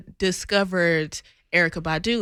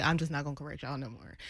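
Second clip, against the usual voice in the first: mild resigned mutter; 5 semitones lower, 12 dB quieter, faster than usual. Retold or imitated. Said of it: "I was like".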